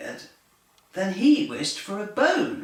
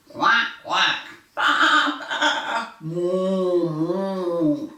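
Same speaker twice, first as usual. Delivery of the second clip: quack, bleat and moo, louder; farm animal noises; 4 dB louder, much slower than usual. A narrator's reading. Reading to children, a noisy farm's animal calls. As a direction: do each farm animal loudly. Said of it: "The farm was very loud too".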